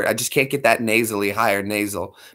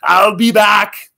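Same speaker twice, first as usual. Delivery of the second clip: big shouted action-hero line, slow at the end; hammed-up Arnold catchphrase; Arnold Schwarzenegger; 4 semitones higher, much slower than usual. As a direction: roar it out like the Terminator. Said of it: "Arnold would have to do his pain".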